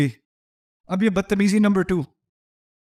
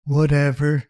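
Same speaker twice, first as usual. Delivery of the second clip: put-on a teenager who couldn't care less; bored teen whatever; 4 semitones lower, much slower than usual. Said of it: "سب سے خطرناک لفظ".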